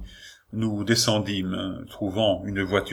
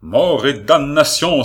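enraged outburst, higher and much louder; furious curse; 6 semitones higher, 8 dB louder than usual. A narrator's reading. The marquis finds the stranger in the room, cursing furiously. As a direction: spit the curse out in fury. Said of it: "s'écria-t-il".

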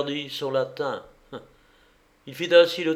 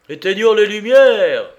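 loud, high shouted order; crowd's cry; 8 semitones higher, 12 dB louder than usual. A narrator's reading. Drunken band calling for darkness, rowdy cry. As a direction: shout it over a rowdy room. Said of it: "cria la bande".

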